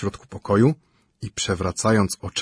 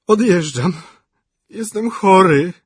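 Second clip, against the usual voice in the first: ordinary delivery, nothing marked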